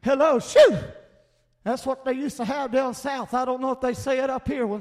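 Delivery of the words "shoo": loud, high, swooping "shoo!"; hillbilly "shoo". Shown as a demonstration. Preacher showing what they say down south when something stinks, loud drawn-out shoo. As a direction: blurt "shoo" like a bad smell hit you. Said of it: "a hillbilly word called shoo".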